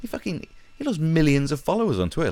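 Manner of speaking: normal talking voice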